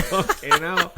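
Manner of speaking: high, laughing exclamation; laughing disbelief. Retold or imitated. Storyteller spotting an old boxer on horseback, laughing disbelief. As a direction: crack up while retelling the surprise.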